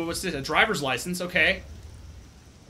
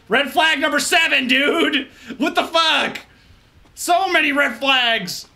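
panicked high yell; 7 semitones higher, 4 dB louder than usual. Real, not acted. Panicking at horror game.

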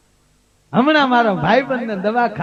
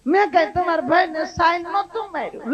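higher, whiny tearful protest; hammed-up tearful excuse; mock crying wife; 7 semitones higher, 4 dB quieter than usual. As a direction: whimper your innocence like a guilty wife. Wife defending herself, crying.

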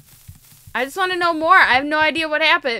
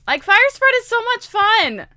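higher, drawn-out enthusiasm; gleeful emphasis; 6 semitones higher, 4 dB louder than usual. Real, not acted. Player tells viewers how fun fire spread is, gleeful. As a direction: gush about it, stretching the words. Repeats it with mounting relish.

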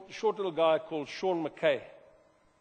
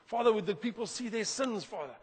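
higher, louder mock preaching prayer; hammed-up sermon disguised as a prayer; preachy prayer; 6 semitones higher, 3 dB louder than usual. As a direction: lecture the room while pretending to talk to God. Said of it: "you also get these preachy prayers".

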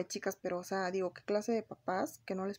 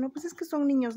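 mocking excuse; 6 semitones higher, 6 dB louder than usual. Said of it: "A veces dicen".

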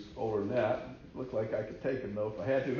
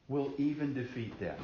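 normal talking voice

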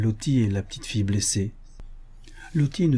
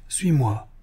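mildly pressing order; a touch of urgency; urgent half-voice call; 3 semitones higher than usual. A narrator's reading. Hankins at the door calling the hypnotised Lottie, urgent. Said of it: "cria à demi-voix d'un ton pressant".

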